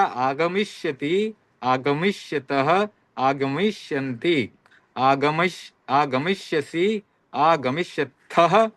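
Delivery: slow, syllable by syllable, each form spelled out clearly; slow careful recitation. Shown as a demonstration. Teacher drilling students in Sanskrit verb forms, slow careful recitation. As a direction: drill it slowly so students catch every sound. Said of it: "तो ये उच्चारण आप ध्यान में रखें".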